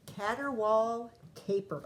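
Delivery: usual speaking voice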